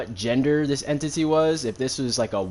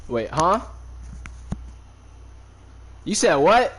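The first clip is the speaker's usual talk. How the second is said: surprised outburst; 6 semitones higher than usual. Real, not acted. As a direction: snap the question in surprise. Reacting to an off-stream interruption.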